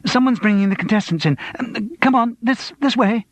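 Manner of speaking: high, flustered urgency; sudden panic. A narrator's reading. Studio guide warning of contestants arriving, panicked. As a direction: herd them out in a panic. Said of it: "sudden panic".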